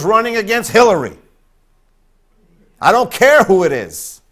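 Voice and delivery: loud and high, preaching at full force; fiery preaching shout. Real, not acted. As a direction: shout your vote to the whole congregation. Preacher declares his vote.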